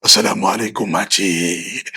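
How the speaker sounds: very low, menacing hello; comically deep, spooky salutation; scary greeting. Shown as a demonstration. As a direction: greet auntie in a deep put-on voice that would frighten her. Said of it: "Takut makcik kau".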